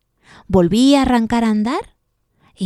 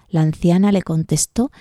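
ordinary delivery, nothing marked

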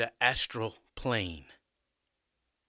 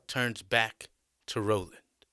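ordinary delivery

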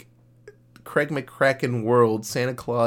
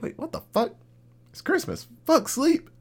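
much higher, mock kids' thrill; sudden excitement. Girls realizing it's Christmas, mock excited squeal. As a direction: flip from drowsy to hyped kid.

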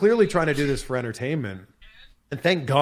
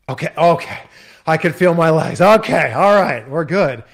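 louder, rising relieved outburst; relieved "okay" getting louder; loud relief; 3 semitones higher, 9 dB louder than usual. Retold or imitated. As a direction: let the relief burst out. Relief on feeling his legs.

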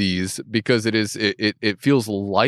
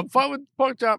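high protesting voice; 13 semitones higher than usual. Real, not acted. Defensive joking reply.

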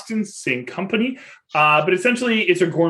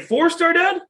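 lightly voiced eager kid, much higher; hopeful kid; 12 semitones higher than usual. Dad imitating his son bargaining for a rating, much higher eager kid voice. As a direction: ask it like a hopeful little boy pushing his luck.

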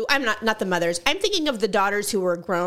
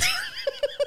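laugh; 5 dB quieter than usual. Real, not acted. Laughing at sister memory.

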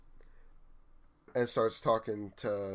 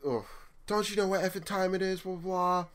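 much higher, far-off voice of a raging customer; angry customer ranting at the clerk at closing; mock screaming customer; 7 semitones higher than usual. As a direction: play the yelling man, pitched way up. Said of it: "starts screaming at me".

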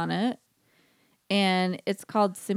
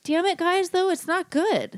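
mock frustrated shout; 10 semitones higher than usual. Real, not acted. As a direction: cry out in mock despair. Complaining about disappointing popcorn.

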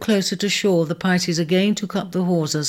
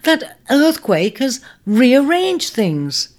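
high, excited and certain; elated; 8 semitones higher, 4 dB louder than usual. A narrator's reading. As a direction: voice rising with joy. Ares excited on recognizing home.

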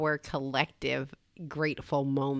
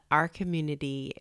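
ordinary talk, nothing marked

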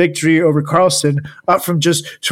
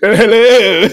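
loud, higher silly British hello; mock British accent; 6 semitones higher, 6 dB louder than usual. Host mimics the chess player turned British, mock accent. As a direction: laugh through a fake British greeting. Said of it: "started talking in a British accent".

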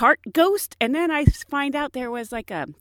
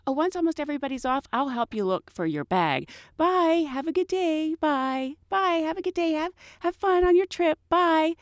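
singsong flight attendant goodbye; 8 semitones higher than usual. Retold or imitated. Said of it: "I'm like".

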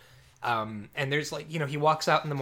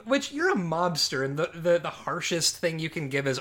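ordinary talk

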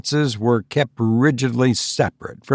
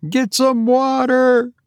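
cried out. A narrator's reading. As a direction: call for help fast. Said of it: "cried Tabitha".

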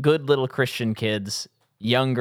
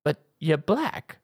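retold movie line; 4 semitones higher, slower than usual. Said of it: "And then he goes".

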